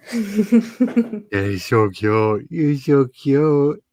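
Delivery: very slow, cooing pet voice. Real, not acted. Cooing at the dog on camera, slow baby talk. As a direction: stretch each word out like cooing to a puppy.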